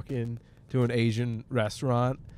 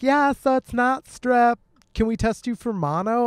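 mocking clinic staff; 9 semitones higher than usual. Retelling the urgent care nurse, higher mock chirpy voice. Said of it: "And they're like".

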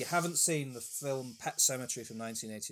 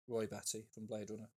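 ordinary delivery

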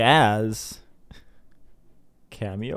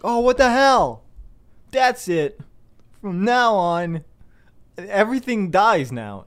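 high, loud hammed-up outburst; God declaring everything dies; mock furious God; 6 semitones higher, 3 dB louder than usual. God voiced furious, cursing everything.

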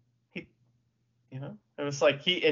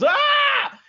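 sudden high, loud wrestler's yell; Yoshihashi yell; 8 dB louder than usual. Retold or imitated. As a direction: blast it out like a hyped wrestler. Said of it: "takes a poop and goes".

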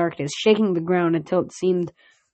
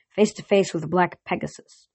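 plain reading voice

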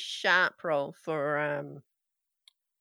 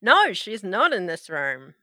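ordinary talk, nothing marked

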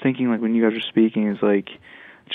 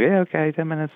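put-on dismissive kid; 7 semitones higher than usual. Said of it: "they're like".

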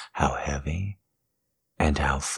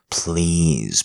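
ordinary talk, nothing marked

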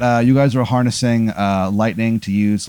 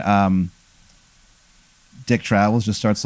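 ordinary talk, nothing marked

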